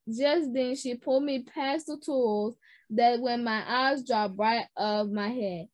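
usual speaking voice

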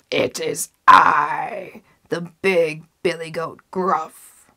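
loud, slow, hoarse big goat; ugly hoarse voice. A narrator's reading. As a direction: announce yourself as a huge rough goat. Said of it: "an ugly hoarse voice of his own".

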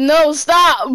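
loud panicked no, stop; yelling. Real, not acted. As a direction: shout it to stop him. Yelling during a gunfight.

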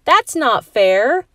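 mock protest shout. A narrator's reading. Heather joining the women's side, protesting. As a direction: object loudly but playfully. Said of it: "shouted Heather".

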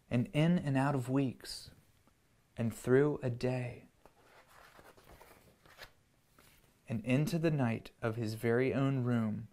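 put-on gentle storybook read-aloud for kids; reading to children. A narrator's reading. Parent reading a picture book to children, gentle storyteller voice. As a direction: tell it like a bedtime story.

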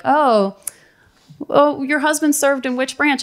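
high-pitched mock polite inquiry; mimicked clueless staffer. VA clerk assuming a veteran is the wife, mocking imitation.

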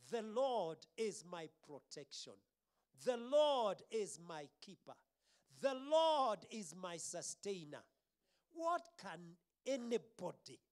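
loud, ringing preacher's proclamation; bold confession of faith. Real, not acted. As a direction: proclaim each phrase forcefully.

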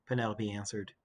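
ordinary delivery, nothing marked

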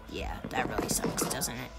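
hushed mock-sympathetic whisper. Real, not acted. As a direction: whisper it like a sad secret. Mock sympathy whisper after loss.

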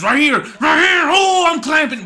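high, loud hyped shouting; excited yell. Real, not acted. Gamer calling his interception spot, loud and high. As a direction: yell it like you know the pick is coming.

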